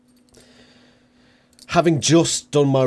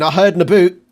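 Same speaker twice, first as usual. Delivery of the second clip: put-on loud teasing Canadian accent, "boot" said as "aboot"; mock Canadian accent; 6 semitones higher, 10 dB louder, faster than usual. Reactor teasing the podcast host's Canadian accent, loud mock Canadian accent. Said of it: "Is he Canadian?".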